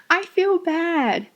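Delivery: slow, high-pitched cheerful lilt; very happy-sounding. Shown as a demonstration. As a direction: say bad news as if delighted. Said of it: "You sound very happy".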